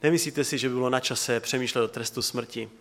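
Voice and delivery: as it echoed in my head. Retold or imitated. Preacher replaying a radio question inside his head, hushed and low. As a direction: let it sink in quietly, like a thought replaying. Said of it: "tak mě to tak jako by znělo v hlavě".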